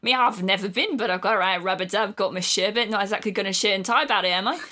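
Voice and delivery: loud Cockney geezer; chirpy London Cockney; Cockney accent. Acted. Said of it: "dropping more H's".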